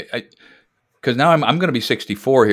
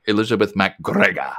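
MacGregor with a rolled Scottish r; playful Scottish burr on "MacGregor"; Scottish accent; 3 semitones lower than usual. Pretend. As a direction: roll the r in MacGregor like a Scot. Scottish ancestor's name said in accent.

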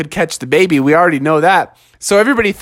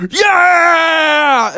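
high, drawn-out cheer; cheering crowd; 9 semitones higher, 3 dB louder than usual. Acted. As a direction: whoop it out long. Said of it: "everybody was like".

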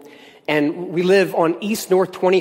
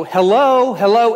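high, raised mock call; calling out, pitched up and louder; retold yell; 5 semitones higher, 5 dB louder, slower than usual. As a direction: act out calling to someone unseen. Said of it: "I yell".

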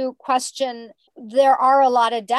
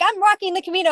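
much higher and louder, eager; mock excitement; 10 semitones higher, 4 dB louder, much faster than usual. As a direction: announce it like a thrilled plan. Retold eager plan, then reversal.

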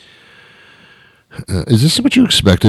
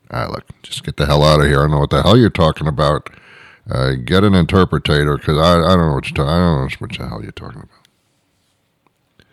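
fast, low, fed-up everyman; ordinary talker; 5 semitones lower, 4 dB quieter, faster than usual. Acted. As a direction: brush them off quickly in a low voice. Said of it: "talk like this".

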